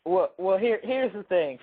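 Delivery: ordinary delivery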